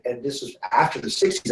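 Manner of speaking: ordinary talk, nothing marked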